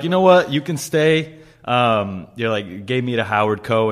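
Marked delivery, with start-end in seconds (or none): 0.0-1.4 higher, louder boss voice granting a reprieve; mock boss verdict. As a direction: play the chef relenting grandly. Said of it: "And then you're like".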